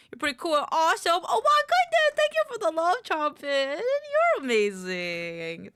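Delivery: loud, high-pitched gush of gratitude; shower the donor with excited praise; gushing thanks. Real, not acted.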